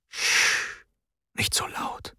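unvoiced hushed warning; whispered hush. A narrator's reading. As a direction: shush the boy without waking the girl. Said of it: "flüsterte er".

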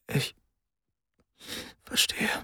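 soft, breaking whisper; quiet cracking voice; broken whisper. A narrator's reading. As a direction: barely hold back tears. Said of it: "flüsterte er mit brüchiger Stimme".

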